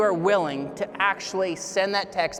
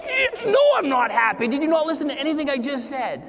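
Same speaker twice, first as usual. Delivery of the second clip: much higher, louder cry of disbelief; exasperated outburst; 7 semitones higher, 3 dB louder than usual. Retold or imitated. Student tells the professor he is not happy. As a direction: blurt it out, stunned he even asked. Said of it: "I was like".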